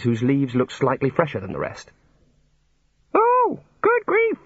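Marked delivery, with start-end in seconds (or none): 3.1-4.4 very high, slow squawked mimicry; chattering birds. A narrator's reading. Birds around the digger mimicking him, chattering. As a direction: squawk it out like a parrot. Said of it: "chattered the birds around him".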